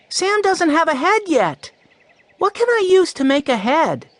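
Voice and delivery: put-on high, eager little boy voice; a six-year-old urgently asking for help; young Benny. Six-year-old Benny worried his scarecrow has no head, high and eager.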